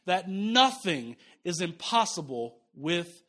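Nothing is impossible with God said very slow, each word weighted; slow emphatic. Shown as a demonstration. Preacher leading the congregation to repeat the line, slowly. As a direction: drag it out, word by word. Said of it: "say it again with me".

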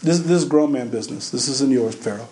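low-pitched, unhurried voice of authority; God's rebuff. Acted. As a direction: put Pharaoh in his place, quietly final. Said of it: "That's what that answer is".

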